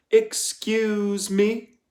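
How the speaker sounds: the warden cutting him off with a slow, drawn-out "excuse me"; icy warden. A narrator's reading. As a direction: stretch each word out, in cold control. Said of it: "the warden said again".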